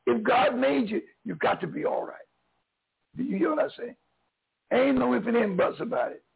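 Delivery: ordinary delivery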